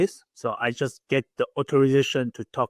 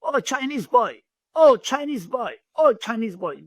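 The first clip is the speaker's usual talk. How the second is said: sing-song teasing call, much higher; mocking taunt; 9 semitones higher, 3 dB louder than usual. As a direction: taunt him like the kids did, pitched well up. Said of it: "They said".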